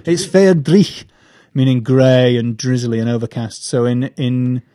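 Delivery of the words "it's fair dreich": Scottish accent. Shown as a demonstration. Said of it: "wonderful Scottish word".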